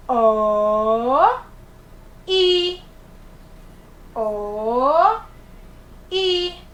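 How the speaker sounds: O and I drawn out, loud and separate; each vowel held long and loud for children; sounding out vowels. Shown as a demonstration. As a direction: spell it out vowel by vowel, slowly. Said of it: "Formou a palavrinha o quê?".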